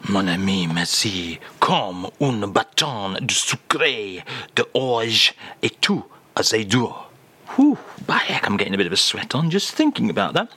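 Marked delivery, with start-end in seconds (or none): 0.1-6.9 French phrase. Acted. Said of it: "If you will".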